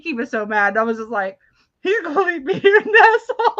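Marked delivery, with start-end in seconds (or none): 1.8-3.5 high, loud wail through laughter; mock panicked wail. Retelling own panic while laughing.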